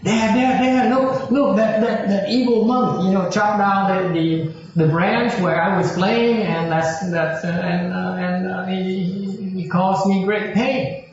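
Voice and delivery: put-on high wailing kid voice, tattling; crying child. Spirit son runs crying to his dad, high whine. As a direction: whine it up high like a hurt boy. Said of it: "they came crying and screaming to his dad".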